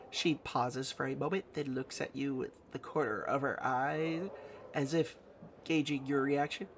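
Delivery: ordinary talk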